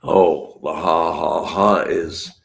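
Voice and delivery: ordinary talk, nothing marked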